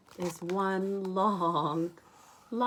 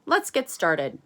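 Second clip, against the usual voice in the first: Arthur agreeing; 9 dB louder than usual. Acted. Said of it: "And Arthur says".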